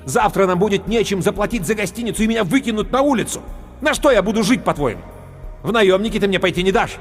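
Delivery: angry rant. A narrator's reading. Hero scolding the sword about lost money. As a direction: lay into the sword.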